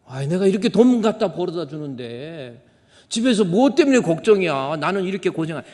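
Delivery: loud, laughing indignant husband; husband protesting he brings money home, loud and half laughing; mock indignant husband. Husband's loud retort mimicked.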